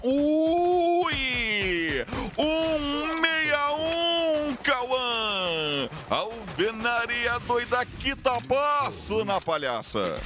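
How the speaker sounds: screaming high hype call; excited shout. Real, not acted. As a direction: explode over the big play. Caster erupts at big play. (grito de narrador empolgado)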